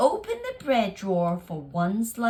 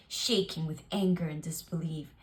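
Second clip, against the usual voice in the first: plain reading voice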